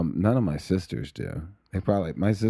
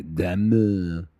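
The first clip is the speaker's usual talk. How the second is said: Diamond in bad French, D almost dropped; French accent, softened D. Shown as a demonstration. Showing her the D has to drop, French accent. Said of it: "You would have to drop the D".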